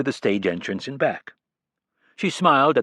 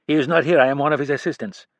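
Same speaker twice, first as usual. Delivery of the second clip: put-on cold French-accented assistant, louder and higher; thick French accent; 5 semitones higher, 4 dB louder, faster than usual. A narrator's reading. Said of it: "in a thick French or French-Canadian accent".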